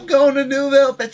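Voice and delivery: high, loud hammed-up Southern yell; Southern accent. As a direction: shout it like a rowdy Southern local. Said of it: "they're all Southern or whatever reason".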